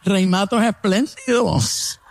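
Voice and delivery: high mocking "Oh", then slow drawl; hammed-up sarcastic praise; mock sarcasm. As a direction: mimic the sneering reply, pitched high. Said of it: "Y él".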